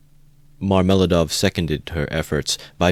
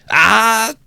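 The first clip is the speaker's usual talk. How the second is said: a high shrieking scream of shock; sudden scream; 8 dB louder than usual. A narrator's reading. Wife sees husband kneeling in the doorway, shrieks. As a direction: shriek at the sight of him. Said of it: "uttered a sudden scream".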